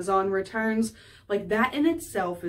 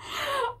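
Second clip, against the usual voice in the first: sharp gasp; 4 dB quieter than usual. Real, not acted. Realizing her word mix-up.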